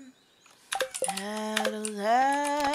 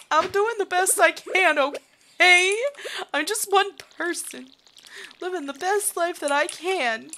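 defensive protest; 8 semitones higher, 7 dB louder than usual. Real, not acted. Defensive rant at the game.

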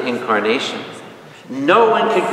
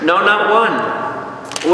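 drawn out, higher; very slow emphasis; 5 semitones higher, much slower than usual. Acted. Preacher stressing that nobody is good, very slow. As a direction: spell out every word slowly.

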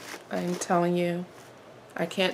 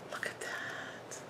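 breathless whisper, much softer; awed whisper; 18 dB quieter, slower than usual. Real, not acted. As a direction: whisper it, too moved to speak. Overwhelmed by the gift.

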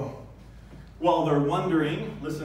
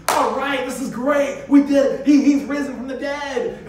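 much higher, much louder celebrating; overjoyed cheer; 12 semitones higher, 10 dB louder than usual. Pretend. Disciples hearing the news, cheering loudly in mock joy. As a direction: cheer like a team that just won. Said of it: "response of the disciples".

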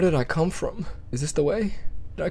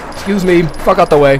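loud, raised-pitch order; impatient shout; 5 semitones higher, 12 dB louder than usual. Real, not acted. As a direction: yell to clear the path. Shoving past things in game.

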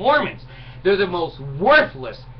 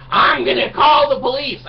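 mock threat; 7 semitones higher, 7 dB louder than usual. Mocking the man's threat.

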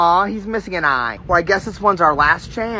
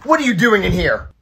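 ordinary talk, nothing marked

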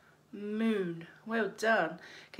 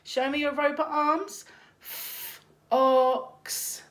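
robot arms; 4 semitones higher, slower than usual. Pretend. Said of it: "let's put on our robot jackets".

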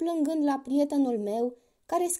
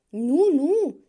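a loud, higher crocodile shout; 4 semitones higher, 8 dB louder than usual. A narrator's reading. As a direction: crocodile crying out in protest. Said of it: "strigă crocodilul".

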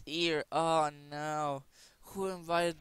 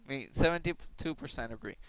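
ordinary talk

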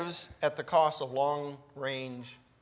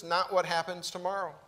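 normal talking voice

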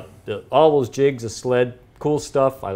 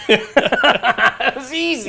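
slow, laughing sarcasm; 10 semitones higher, much slower than usual. Real, not acted. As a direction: chuckle through it slowly.

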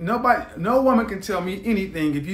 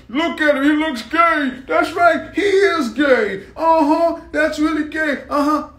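high, loud imitation of catty agreement; hammed-up chorus of jeering gossip; mock gossiping simps; 7 semitones higher, 4 dB louder than usual. Simps running over to talk about him, mock gossip.

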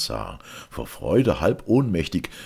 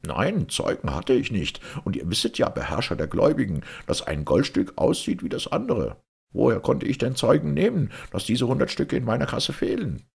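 higher, agitated merchant voice; defensive merchant; 8 semitones higher, faster than usual. A narrator's reading. Merchant justifying himself to the Caliph, defensive. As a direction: plead your case, pitched up.

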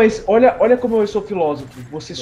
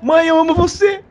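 high, loud shout-out; excited shout; 11 semitones higher, 5 dB louder than usual. Acted. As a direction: yell it to mom, thrilled. Shout-out to mom on stream.

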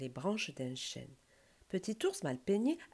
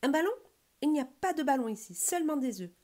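high, loud, put-out reply; indignant stork; 8 semitones higher, 5 dB louder than usual. A narrator's reading. Stork telling bear about the eggs, indignant. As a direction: answer high and loud, affronted.

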